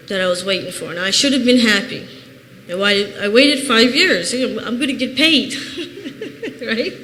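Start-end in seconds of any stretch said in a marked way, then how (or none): 5.6-6.6 rueful high laugh. Real, not acted. Rueful laugh about getting paid.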